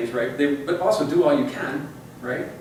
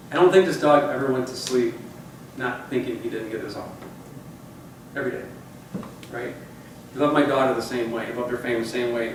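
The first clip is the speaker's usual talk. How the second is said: normal talking voice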